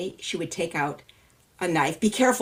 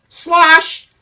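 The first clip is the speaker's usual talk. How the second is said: big loud higher slosh; 10 semitones higher, 12 dB louder than usual. Acted. Storyteller leading children in splash word, loud. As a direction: make the splash huge.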